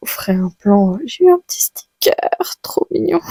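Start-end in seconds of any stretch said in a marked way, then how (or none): 1.1-3.2 cute gushing. Real, not acted. Cooing over a cute sticker.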